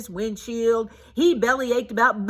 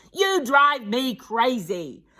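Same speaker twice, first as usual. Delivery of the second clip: yelled; 4 semitones higher, 3 dB louder, slower than usual. A narrator's reading. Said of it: "he yelled".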